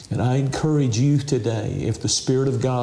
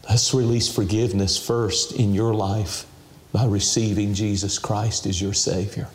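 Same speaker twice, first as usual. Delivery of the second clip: ordinary delivery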